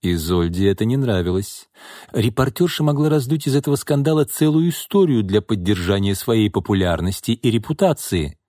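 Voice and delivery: plain reading voice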